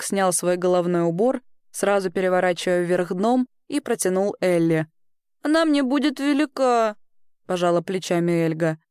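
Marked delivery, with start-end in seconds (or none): 5.4-7.0 put-on higher-pitched child's voice; little girl. Girl shrugging at an offered hat, high and childlike. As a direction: lift the pitch into a girl's voice.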